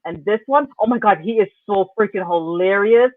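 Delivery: normal talking voice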